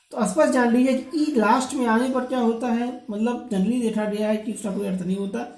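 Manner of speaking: ordinary talk, nothing marked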